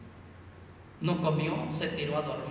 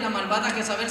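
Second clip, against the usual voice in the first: ordinary talk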